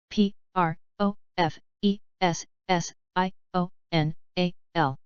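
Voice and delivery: slow, letter by letter; unhurried classroom spelling. Shown as a demonstration. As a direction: spell each letter out slowly. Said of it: "spelling drill, slow then fast".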